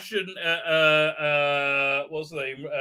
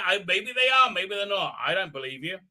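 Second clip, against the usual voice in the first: ordinary talk, nothing marked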